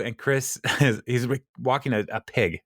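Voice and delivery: ordinary talk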